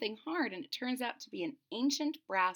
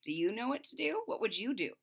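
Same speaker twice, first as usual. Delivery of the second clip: ordinary delivery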